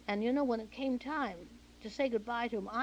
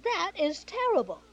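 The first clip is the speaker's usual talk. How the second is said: mock dismay, pitched higher and louder; replaying her horror at the playback, up high and loud; shocked outburst; 9 semitones higher, 7 dB louder than usual. Actress hearing her own voice recorded, shocked and loud. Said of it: "I said".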